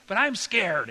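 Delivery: high, loud mock-indignant kid outburst; indignant scared boy. Ten-year-old boy admits fear to his dad, loudly indignant. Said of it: "he got really indignant".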